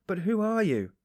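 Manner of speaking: high, loud, nervous cry; uneasy cry. A narrator's reading. Uneasy workman crying out at the stranger, high and loud. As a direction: call out, rattled and uneasy. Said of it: "the workman cried, uneasy".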